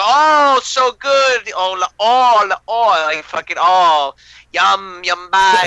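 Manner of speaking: high, hammed-up yum; mock delight. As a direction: fake relish while hiding the food. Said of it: "we'll go".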